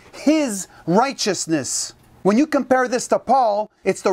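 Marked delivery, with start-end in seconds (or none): 0.1-1.9 "his righteousness" slowed right down and dwelt on; slow, deliberate emphasis; stress on righteousness. Shown as a demonstration. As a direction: lean on "his righteousness" word by word. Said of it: "underline this".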